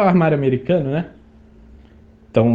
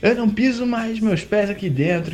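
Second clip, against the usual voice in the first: high imitated yell of defiance; mock angry outburst, pitched up; retold angry refusal; 10 semitones higher, 3 dB quieter than usual. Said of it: "Gritando assim".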